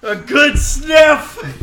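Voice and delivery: slow, loud laughing delivery. Real, not acted. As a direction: shout it through laughter. Reading card while laughing.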